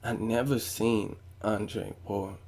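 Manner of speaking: very soft, slow imitation of a placid trainer; mock laid-back murmur, no urgency; calm and collected. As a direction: say it quietly, unbothered, taking your time. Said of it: "just real calm and collect".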